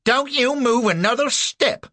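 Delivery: high, loud shrill command; fierce little woman; little woman crying out. A narrator's reading. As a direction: cry out to stop him. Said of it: "she cries out".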